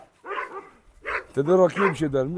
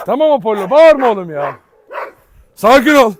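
firm raised calming voice; loud calming; 4 semitones higher, 8 dB louder than usual. Real, not acted. Owner shouting to calm barking Apollo, loud.

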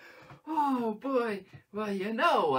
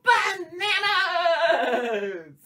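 much louder, much higher, slow stretched yell; shout; 7 semitones higher, 13 dB louder, much slower than usual. Acted. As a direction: burst out at the end of the chant. Chant climax shout.

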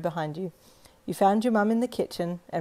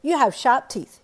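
put-on higher, much louder blunt little girl; blunt child; 7 semitones higher, 10 dB louder than usual. Little girl points out the boy's teeth, blunt and loud. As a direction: state it flatly like a frank kid. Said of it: "you stated bluntly".

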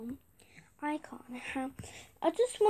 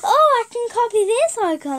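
mock copycat; 7 semitones higher, 8 dB louder than usual. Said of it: "people are like".

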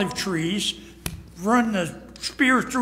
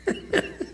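high laugh; 7 dB quieter than usual. Acted. Holy Spirit leans over, laughs.

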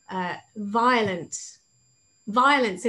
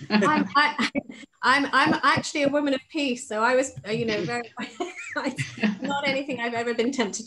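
higher, louder, stumbling through laughter; laughing reply; 4 semitones higher, 5 dB louder than usual. Real, not acted. Author says she is a woman of peace, laughing. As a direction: answer while real laughter breaks in. Amused answer to killing question.

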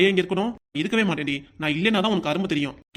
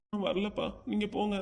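put-on timid child; 8 dB quieter than usual. Child sends father away.

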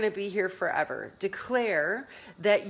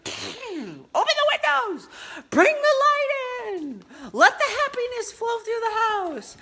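joyful wake-up call; 4 dB louder than usual. Retold or imitated. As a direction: throw the windows open with a bright call. Cheerful morning wake-up call.

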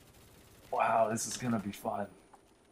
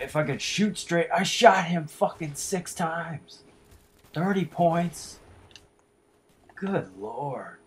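high, loud and slow complaint; exasperated yelling; 8 semitones higher, 9 dB louder, slower than usual. Real, not acted. Gamer yells that six shots only did thirty points, exasperated. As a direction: drag out the disbelief. Shots not killing enemy.

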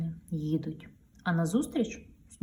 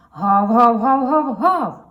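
slow loud higher barking along; dog barking; 5 semitones higher, 13 dB louder, much slower than usual. Acted. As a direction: bark like a little dog singing along. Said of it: "підспівує песик". (гавкіт песика)

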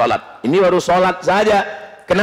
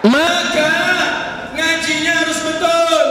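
higher, forceful call; preaching shout; 10 semitones higher than usual. Real, not acted. Preacher urging the congregation to recite correctly, forceful. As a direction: hammer the word betul. Preacher drives home the point.

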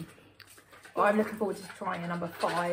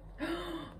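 sharp gasp; 10 semitones higher, 6 dB quieter than usual. Real, not acted. Reacts to sour candy.

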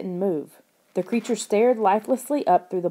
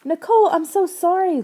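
much higher, loud anguished apology; crying out; 11 semitones higher, 6 dB louder than usual. A narrator's reading. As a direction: beg forgiveness in tears. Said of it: "Lauren cried".

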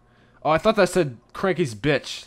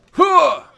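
announcer grunt; 10 dB louder than usual. Pretend.

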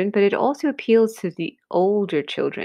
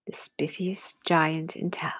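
whispered title; 4 semitones lower, 7 dB quieter than usual. A narrator's reading. Teacher whispering the book title to children. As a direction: whisper the title, hushed and mysterious.

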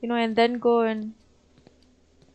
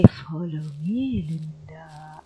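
imitating a creepy whisper; 6 semitones lower, slower than usual. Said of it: "when they say".

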